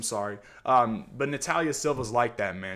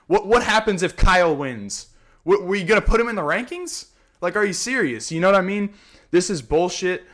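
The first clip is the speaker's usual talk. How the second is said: higher, louder, exasperated ranting; angry rant; 5 semitones higher, 6 dB louder than usual. Real, not acted. Fight podcaster ranting at the UFC matchmaking, loud and exasperated. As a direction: vent at the matchmakers, fired up. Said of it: "Sorry for the little rant".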